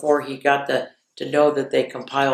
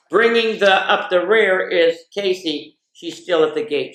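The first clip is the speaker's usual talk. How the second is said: raised, excited play-by-play call; race announcer; 4 semitones higher, 4 dB louder than usual. Acted. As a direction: call the start like a horse race. Mock horse-race call of family morning.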